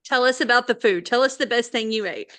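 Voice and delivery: ordinary delivery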